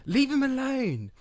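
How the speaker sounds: high, sharp warning; protective Stan. Acted. Stan warns Carrot off the teddy bear, high. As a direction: tell him to back off.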